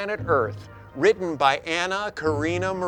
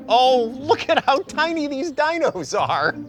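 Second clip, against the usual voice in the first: cooing; 10 semitones higher than usual. Acted. Cooing over tiny dinos.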